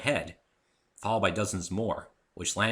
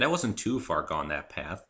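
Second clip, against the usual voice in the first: ordinary delivery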